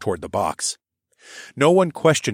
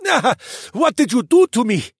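much higher and louder pained cry; yowl of pain; 7 dB louder than usual. A narrator's reading. The cheetah yowls at his captor in pain. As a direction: cry it out as the arms pop back. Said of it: "yowled in pain".

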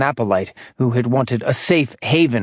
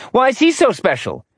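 sceptical doctor; 10 semitones higher than usual. Acted. Doctor questions Braden.

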